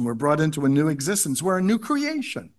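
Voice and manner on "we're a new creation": "new" lifted in a higher-pitched phrase; stress on new. Real, not acted. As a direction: lean on "new" as the pitch climbs. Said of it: "Emphasis on the word new".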